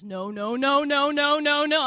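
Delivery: sharp high loud rising refusal; a ghostly voice warning her off; urgent, loud, high no-no-no; frantic no. Retold or imitated. Voicing a sudden strong grave warning.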